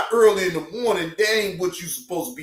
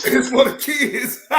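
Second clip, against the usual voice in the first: higher, slow, laughing through it; laughing mock repeat; 6 semitones higher, 4 dB louder than usual. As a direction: echo the line while cracking up. Mocking repeat of the slogan.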